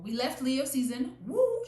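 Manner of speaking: high, loud whoop of celebration; excited cheer. Real, not acted. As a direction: cheer for the Leos. Celebrating her zodiac season.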